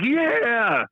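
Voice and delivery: high mock kids' cheer; kids cheering. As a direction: squeal it like excited kids.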